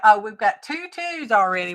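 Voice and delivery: ordinary talk, nothing marked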